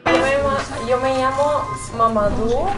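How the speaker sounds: normal talking voice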